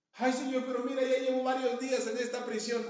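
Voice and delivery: loud, high mock plea; pleading complaint. Paul complaining to God from prison, hammed-up loud plea.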